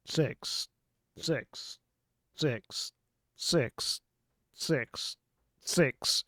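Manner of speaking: stuck looping robotic count; automated voice. Acted. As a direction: repeat like a broken recording. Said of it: "automated voice".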